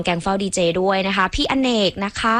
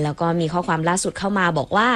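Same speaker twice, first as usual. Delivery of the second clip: usual speaking voice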